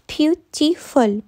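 "beautiful" slowly in three separate beats; syllable by syllable. Shown as a demonstration. Teacher showing the class three syllables, "beautiful" slowly split. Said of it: "here we have three syllables".

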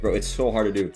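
ordinary talk, nothing marked

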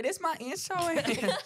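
high, drawn-out dumb voice. Shown as a demonstration. Goading a friend to do the insurance bit, dumb voice. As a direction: drag it out in a silly dumb voice. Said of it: "with her dumb voice".

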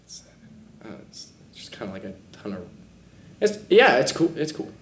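halting, underwhelmed dad; soft, half-hearted approval with long hesitations; unimpressed dad. Acted. Dad looking at the Grand Canyon, unimpressed and hesitant. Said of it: "a dad unimpressed with the Grand Canyon".

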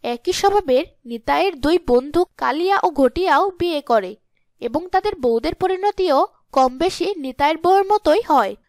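ordinary delivery, nothing marked

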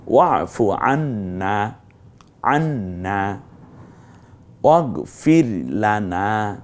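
slow recitation, each ending drawn out a little; slow recited ওয়াফু আন্না; recited verse. Shown as a demonstration. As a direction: recite it slowly, lightly lengthening the final a. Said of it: "এক আলিফ হালকা একটু টানতে হবে".

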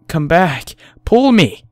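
high, loud, urgent order rising in force; yelling. A narrator's reading. Fallen man calling his friend back, yelling. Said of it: "I yell".

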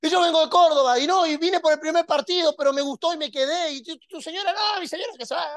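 high, loud try at the Córdoba lilt on "Córdoba"; attempted Córdoba accent. Shown as a demonstration. Preacher retrying the fan with a Córdoba accent, high and loud. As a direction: stretch "Córdoba" in a Córdoba sing-song tune. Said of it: "era con la tonada".

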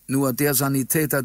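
plain reading voice

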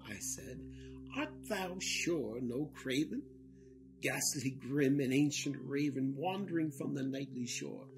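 usual speaking voice

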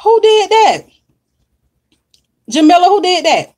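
shocked demand to know; surprised outcry. Real, not acted. Host reacting to a viewer's news, loud and shocked.

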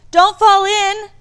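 high, loud, drawn-out yell; panicked child's warning cry; screamed warning. A narrator's reading. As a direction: scream it slowly across the distance. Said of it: "David screamed".

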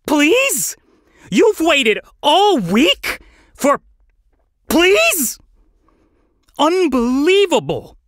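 high, loud incredulous screech; exasperated shriek, pitch way up. Acted. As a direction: screech in outraged disbelief. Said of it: "Felix screeched at the nitwit".

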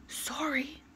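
a soft, voiceless little sorry; whispered. Acted. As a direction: breathe the apology so nobody hears. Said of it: "whispered Mister Happy".

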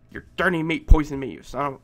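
normal talking voice

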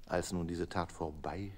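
usual speaking voice

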